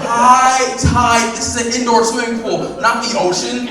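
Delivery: high, loud disbelieving shout; shouting at the clerk over her excuse; incredulous outrage. Acted. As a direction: yell it in total disbelief.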